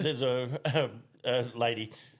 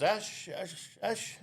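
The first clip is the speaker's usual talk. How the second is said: calling out; 7 semitones higher, 3 dB quieter, much slower than usual. Acted. Doctor calls out to waiting patient.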